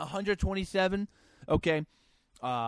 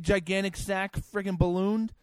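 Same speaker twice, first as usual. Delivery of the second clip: ordinary delivery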